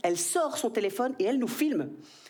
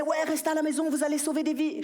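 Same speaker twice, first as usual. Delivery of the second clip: higher, sing-song parody of the lockdown slogan; lightly mocking public message; mock official advice; 6 semitones higher than usual. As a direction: mimic a preachy announcement. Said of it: "On nous disait".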